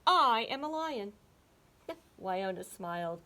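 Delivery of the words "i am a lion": proud declaration. Acted. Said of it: "Hugo told her".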